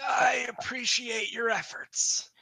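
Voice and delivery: high, slow creature voice; high-pitched, drawn-out kobold courtesy. Acted. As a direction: accept an apology as a small kobold.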